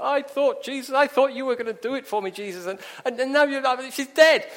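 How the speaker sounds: high, loud, stumbling protest; anguished reproach. Acted. Bereft father reproaching Jesus for being too late, anguished outburst. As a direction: plead and accuse, building to a cry.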